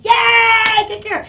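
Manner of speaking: high, loud cheer for a dog; big squealed celebration; over-the-top praise. Real, not acted. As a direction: burst into delighted praise. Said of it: "over-praise her with my".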